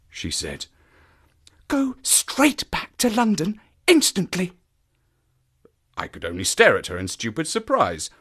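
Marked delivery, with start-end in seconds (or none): 1.7-4.5 high, breathy, urgent woman; low, eager voice. A narrator's reading. Stapleton's sister urges a stranger away, low and eager. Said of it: "She spoke in a low, eager voice".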